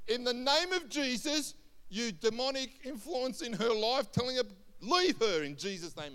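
acted preacher's command; commanding exorcism. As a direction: order the spirit out with force. Paul commands a spirit to leave.

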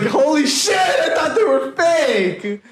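very high, drawn-out mock shout; hammed-up astonishment. Friend joking about meeting an actual British person, astonished high shout. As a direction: shriek in exaggerated disbelief. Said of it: "I was like".

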